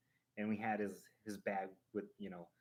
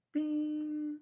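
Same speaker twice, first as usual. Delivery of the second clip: much higher, louder sung ding sound; sung bing chime; 6 dB louder than usual. Real, not acted. Showing viewers the hidden Mickey, sung chime. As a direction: chime it like a game bell.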